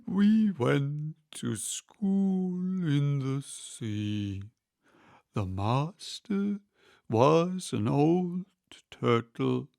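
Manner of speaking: slow, mournful turtle, halting; sobbing a little. Acted. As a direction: push through with a hint of sobs. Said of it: "though still sobbing a little now and then".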